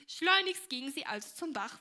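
plain reading voice

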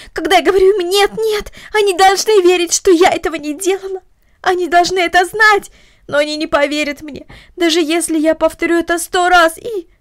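put-on high, loud agitated girl; distraught pleading. A narrator's reading. Girl complaining to Martha that no one believes her, distraught. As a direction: plead your case in distress.

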